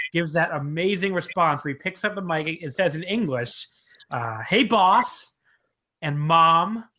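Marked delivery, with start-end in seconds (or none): 4.4-6.8 loud, punchy quoted announcement; mic callout. Retold or imitated. Podcaster retelling a wrestler's reply, loud mic callout. Acting out Yohei's mic response.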